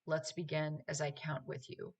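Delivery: ordinary talk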